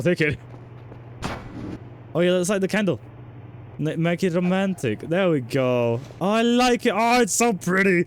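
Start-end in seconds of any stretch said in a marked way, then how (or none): 6.2-8.1 excited. Real, not acted. Admiring the candle decoration.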